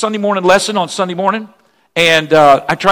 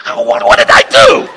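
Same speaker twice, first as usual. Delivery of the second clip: shocked disbelief; slower than usual. Retold or imitated. Pastor hearing the donor take her pledge back, stunned high cry. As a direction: shout it as if the floor just dropped out. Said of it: "I was like".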